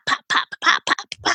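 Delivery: unvoiced-sounding puffed pops, in quick bursts; imitated grease popping. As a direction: make the noise of spattering grease.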